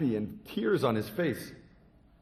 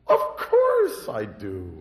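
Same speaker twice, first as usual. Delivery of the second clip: drawn out, loud and eager; the boy says it with big confidence; slow loud eager boy; 8 dB louder, much slower than usual. Acted. Boy answering the monk eagerly.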